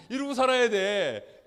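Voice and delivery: ordinary delivery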